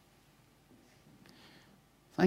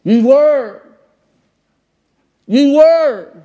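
booming as if through a loud hailer; a huge emphatic high shout; 15 dB louder, much slower than usual. Shown as a demonstration. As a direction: blast it out like a megaphone. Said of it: "shouting through it".

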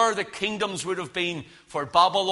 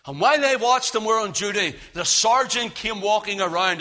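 ordinary talk

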